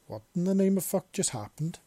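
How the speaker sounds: high, loud shocked outburst; stunned exclaim. Acted. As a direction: blurt it out in disbelief. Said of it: "the newcomer exclaimed".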